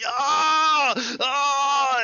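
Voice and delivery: high piercing scream of pain; agonized scream. Acted. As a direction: scream in agony. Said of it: "il crie". (hurlement de douleur)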